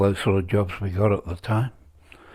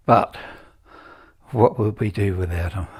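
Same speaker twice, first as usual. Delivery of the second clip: ordinary talk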